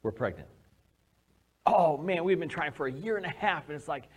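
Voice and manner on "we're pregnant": soft announcement. Retold or imitated. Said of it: "And she says".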